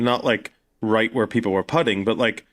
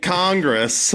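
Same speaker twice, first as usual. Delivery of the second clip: "Congress" in American, drawn out and higher; American accent; 8 semitones higher than usual. Retold or imitated. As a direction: correct it to an exaggerated American "Congress". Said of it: "Oh, there's the Congress building".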